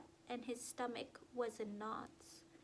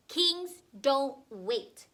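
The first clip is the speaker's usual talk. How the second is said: slow, loud bossy yell; 3 semitones higher, 12 dB louder, much slower than usual. A narrator's reading. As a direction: shout it down like a bossy king. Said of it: "Connor yelled".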